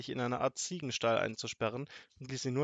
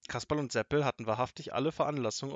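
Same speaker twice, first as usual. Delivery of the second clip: usual speaking voice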